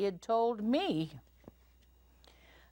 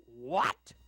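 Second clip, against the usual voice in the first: big papa bear question, not pitched up; booming Papa Bear; 2 semitones lower than usual. A narrator's reading. Papa Bear reacts to the intruder, booming. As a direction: boom out an astonished question. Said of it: "asked Papa Bear in his booming voice".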